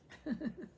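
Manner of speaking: short, high chuckle; real giggle, trailing out; laugh. Real, not acted. Host amused after reading out a quote, laughing.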